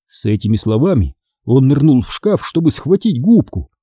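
plain reading voice

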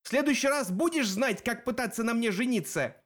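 high-pitched jeering; mocking taunt. A narrator's reading. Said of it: "насмехалась Клариса".

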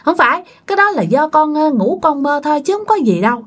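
high-pitched grandfatherly reassurance; dismissive denial. A narrator's reading. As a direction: brush off the child's fear. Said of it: "ông ngoại cứ bác ra". (giọng ông gạt đi, trấn an)

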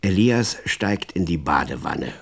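ordinary delivery

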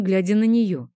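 ordinary delivery, nothing marked

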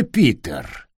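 low rasping call; creaky voice. A narrator's reading. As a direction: drop low and creak the name. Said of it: "скрипучий голос".

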